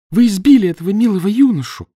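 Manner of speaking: high, loud angry outcry; крик. A narrator's reading. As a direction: scold him at full voice. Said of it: "кричала она". (возмущённый крик жены)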